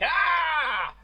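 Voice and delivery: high, loud creature shout; monster roar. Acted. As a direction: leap into the fight with a wild cry. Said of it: "a Towser that jumps in there being like".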